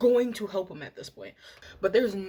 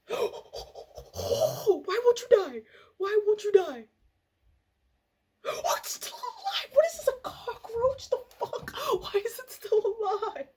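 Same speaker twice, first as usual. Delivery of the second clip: high panicked shrieks. Real, not acted. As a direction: shriek it, freaked out by the cockroach. Panicking at the live bug.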